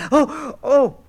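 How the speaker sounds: high startled oh; quick high cry of alarm; startled exclamation. Acted. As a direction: jump with alarm. Orphée turns round again.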